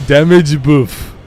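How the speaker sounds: "Damage buff" stretched slowly in a put-on French accent; drawn-out French-accented "Damage buff"; slow French chef voice. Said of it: "sounds like a French chef".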